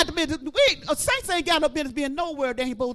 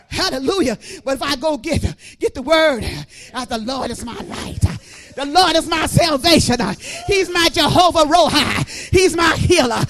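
loud, higher preaching, slowing on Jehovah; full-force preaching; 7 semitones higher, 10 dB louder than usual. Acted. Preacher proclaiming God's names to the church, loud full-force preaching. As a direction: call out each name of God like a climax.

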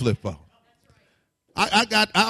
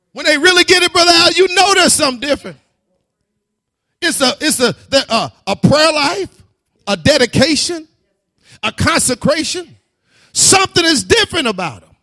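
higher, louder preaching; rising preaching; 8 semitones higher, 12 dB louder than usual. Acted. Preacher telling of changed lives, rising fervor. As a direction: lift the church with it.

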